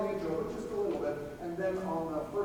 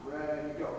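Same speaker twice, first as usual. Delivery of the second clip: ordinary talk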